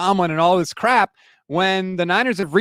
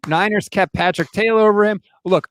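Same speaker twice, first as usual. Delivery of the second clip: ordinary talk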